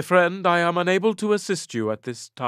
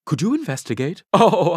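ordinary delivery

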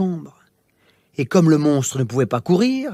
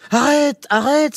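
frightened cry; 9 semitones higher, slower than usual. Acted. King grabbed by the monster, crying out high in fear. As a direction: beg him to stop, terrified. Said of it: "s'écria le roi".

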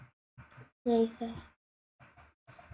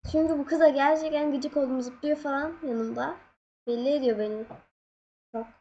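ordinary talk

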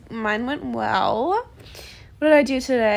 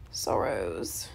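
normal talking voice

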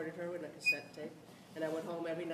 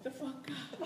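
laughing; 10 semitones higher, 16 dB louder, slower than usual. Retold or imitated. Laughing relief after praise.

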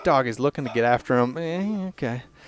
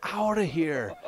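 slow, higher voice for a dog; talking dog; 5 semitones higher, 3 dB quieter, slower than usual. Acted. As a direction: voice the dog, drawing out each word. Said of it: "this dog just says".

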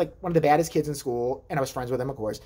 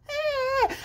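high, thin drawn-out cry; mimicked infant whine; imitated baby squeal. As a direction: squeal like a little devil baby.

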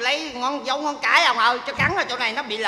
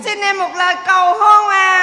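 incredulous; 7 semitones higher, 8 dB louder than usual. Acted. Bride mocks his proposal.

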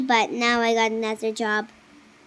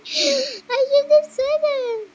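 high slow squeak; 13 semitones higher, slower than usual. Acted. Kid playing game, high slow voice.

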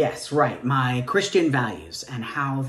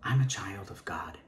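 breathy whispered declaration; whispered child of God; whispered plea; 6 semitones lower, 6 dB quieter than usual. Acted. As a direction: hold it close and insist softly. Quiet defiant plea.

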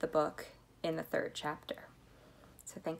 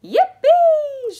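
very high, loud whoop; soaring celebratory yell; joyful shout; 18 dB louder than usual. A narrator's reading. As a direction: whoop with glee. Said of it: "shouted Grandpa Joe".

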